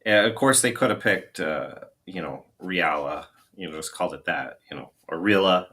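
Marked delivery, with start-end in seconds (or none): 2.6-3.3 "Riala" said ree-AL-uh; alternative pronunciation. Shown as a demonstration. Guest trying a film name, "Riala" as ree-AL-uh. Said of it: "not sure how you pronounce it". 5.2-5.7 "Rila" said RILL-uh; alternative pronunciation. Shown as a demonstration. Guest unsure of a film name, "Rila" as RILL-uh. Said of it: "not sure how you pronounce it".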